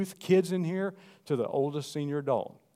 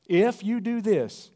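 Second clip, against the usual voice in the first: higher, louder, slow; rising preacher shout; 8 semitones higher, 9 dB louder, slower than usual. Acted. Preacher voicing God's promise, slow shout. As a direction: lift it into a shout.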